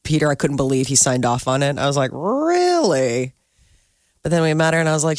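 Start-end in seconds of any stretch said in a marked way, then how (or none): 2.1-3.3 drawn-out, higher-pitched incredulous question; retold disbelief. Mother recalling her husband agreeing to the name, drawn-out incredulous. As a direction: stretch it out in mock disbelief.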